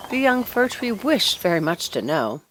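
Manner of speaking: ordinary delivery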